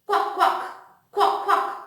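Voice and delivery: duck quack. Shown as a demonstration. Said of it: "Then this is a duck".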